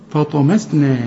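فطمسنا with thin fa and na, only ط heavy; correct light letters. Shown as a demonstration. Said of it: "كلها خطأ". (فطمسنا بالنطق الصحيح المرقق)

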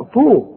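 طو thick and heavy, loud and high; heavy emphatic ط. Shown as a demonstration. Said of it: "الطاء مفخمة دائمًا".

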